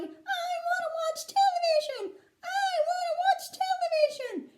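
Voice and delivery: very high squeaky tantrum; tiny tantrum yell. A narrator's reading. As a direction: scream it in a miniature boy's squeak. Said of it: "screaming and yelling".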